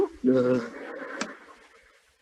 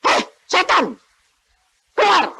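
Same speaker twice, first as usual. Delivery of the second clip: rebuking shout; 11 semitones higher, 3 dB louder than usual. Retold or imitated. Rebuking demons.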